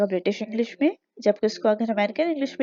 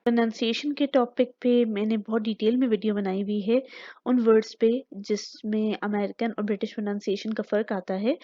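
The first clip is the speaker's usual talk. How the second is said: usual speaking voice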